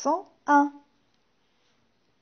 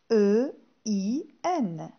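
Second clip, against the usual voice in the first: slow spelled letters; slower than usual. Shown as a demonstration. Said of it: "le son un s'écrit avec".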